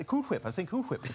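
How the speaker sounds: insistent hwip for "Whip"; "Cool Whip" with a breathy H in "Whip"; emphasis on the H. Pretend. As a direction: push an H before the w in "Whip". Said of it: "putting a lot of emphasis on the H".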